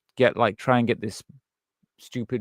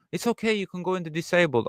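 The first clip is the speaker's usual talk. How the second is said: higher, patronising fake-kind voice; mock a patronising official, pitched up; condescending quote; 7 semitones higher than usual.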